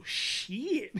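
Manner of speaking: high, stretched "sheee-it"; baby copycat. Retold or imitated. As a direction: mimic a toddler echoing the word back. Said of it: "She look at me, she go".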